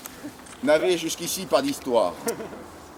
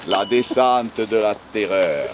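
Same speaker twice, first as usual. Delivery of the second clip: dramatic announcer; 6 semitones lower than usual. Pretend.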